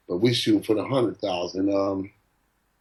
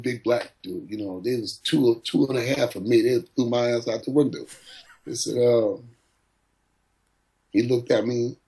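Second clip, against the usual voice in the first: ordinary talk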